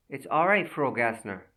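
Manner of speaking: ordinary delivery, nothing marked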